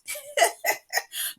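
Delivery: high, soft giggle; quick dismissive laugh; scoffing laugh. Real, not acted. Laughs at her own dismissal.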